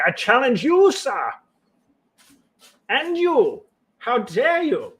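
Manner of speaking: a haughty dog throwing down the gauntlet, hammed up; pompous dog challenger. Dogs voiced as pompous duelists.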